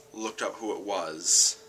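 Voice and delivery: usual speaking voice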